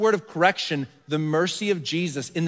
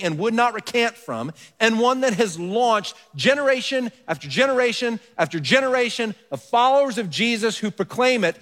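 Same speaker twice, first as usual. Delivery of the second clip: full-force preaching; 6 semitones higher than usual. Acted. Preacher proclaiming the resurrection to the congregation, at full force. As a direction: build to a triumphant declaration.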